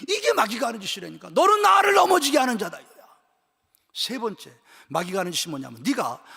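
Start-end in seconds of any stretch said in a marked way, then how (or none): 1.3-2.8 high, loud cry of rebuke; stern accusation. Acted. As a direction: hurl the accusation from the pulpit. Quotes Jesus's accusation to Satan.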